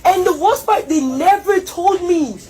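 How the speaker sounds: ordinary talk, nothing marked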